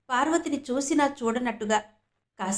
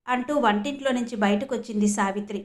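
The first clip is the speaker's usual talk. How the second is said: usual speaking voice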